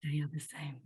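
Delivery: hushed, awed whisper. Real, not acted. To the guest, a hushed whisper of wonder. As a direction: breathe it like a secret revealed.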